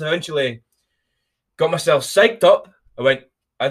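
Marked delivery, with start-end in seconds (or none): none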